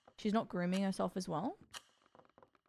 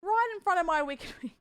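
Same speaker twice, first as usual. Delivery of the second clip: put-on thick, louder Aussie accent with a laugh; extremely Aussie accent; 8 semitones higher, 5 dB louder than usual. Streamer repeating her wings joke in an over-the-top Aussie accent. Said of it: "The extremely Aussie accent".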